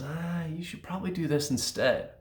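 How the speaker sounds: a high, soft little voice giving advice; little inner voice. Acted. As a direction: voice the gentle nudge from inside. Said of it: "this little voice that just kinda comes up and says".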